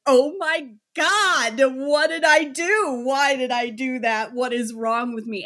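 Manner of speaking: high, loud, slow horrified regret; panicked remorse. Retold or imitated. Lecturing the boys, imitating remorse: high, loud, anguished. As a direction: act out sudden horror at your own cruelty. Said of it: "thirty seconds later you're like".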